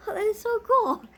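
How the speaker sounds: high, loud burst of excitement; thrilled. Real, not acted. Unboxing a gift from a friend, loud and thrilled. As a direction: gush over it, delighted.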